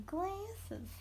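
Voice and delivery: quiet silly Southern drawl on "Glasses"; playful Southern accent. Pretend. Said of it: "that was just me being silly".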